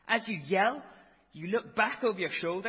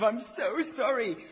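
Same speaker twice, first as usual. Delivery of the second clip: mild tearful gasped apology, higher; gasping sorry; 7 semitones higher, 8 dB louder than usual. A narrator's reading. Driver to the boss, a mild tearful gasp. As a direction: gasp it out through tears. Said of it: "Gasps of".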